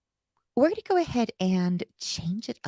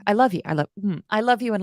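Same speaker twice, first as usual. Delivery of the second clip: normal talking voice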